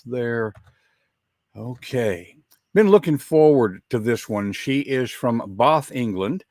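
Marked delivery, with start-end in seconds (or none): 5.6-5.9 Bath with a long ah, British style; British pronunciation. Shown as a demonstration. Southern host naming his guest's English hometown, British pronunciation. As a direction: say Bath as "Bahth". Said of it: "gave me the correct pronunciation".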